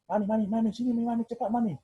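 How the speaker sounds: calling a name high and urgent. Retold or imitated. Pastor calling his wife to come quickly, urgent. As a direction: call them over fast, alarmed.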